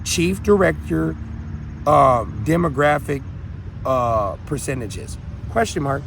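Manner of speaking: slow, spelled-out search words; deliberate slow dictation; slow typing dictation. Shown as a demonstration. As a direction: read it out as if typing. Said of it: "go to Google and type in".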